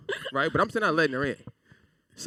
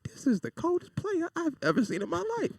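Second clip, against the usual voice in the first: much higher, amazed aside; awed. Retold or imitated. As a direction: son amazed at his father, high and awed. Awed inner comment.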